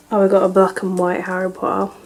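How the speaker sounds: normal talking voice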